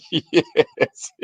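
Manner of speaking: word swallowed by laughter; laughing. Real, not acted. Answering grandmother's question, helplessly laughing. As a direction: crack up while answering.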